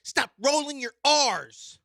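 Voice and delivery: high, loud mock-angry caller. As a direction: bark it like an outraged listener. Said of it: "I had a guy say".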